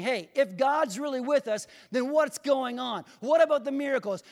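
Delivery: loud, high-pitched fussy protest; fussing. Acted. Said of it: "Gideon is just fussing".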